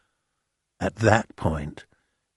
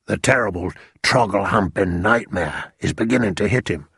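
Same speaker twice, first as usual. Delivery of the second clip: ordinary delivery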